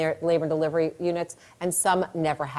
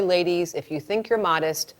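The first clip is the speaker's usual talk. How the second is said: normal talking voice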